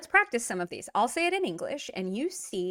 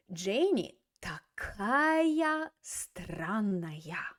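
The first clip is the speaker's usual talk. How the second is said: slow model phrase; much slower than usual. Shown as a demonstration. Teacher models Russian phrase.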